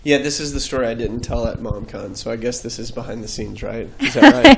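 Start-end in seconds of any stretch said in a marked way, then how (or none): 4.0-4.6 high, loud squeak of a question; playful falsetto check-in with the host; mock squeaky question. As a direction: toss it off in a silly squeaky voice. Joking aside to host.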